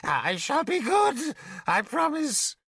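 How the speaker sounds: high, slow, begging whimper; whimpering plea. Acted. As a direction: plead like a beaten child.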